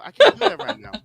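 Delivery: high, loud laughing protest. Real, not acted. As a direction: laugh it out in disbelief. Laughing while refusing.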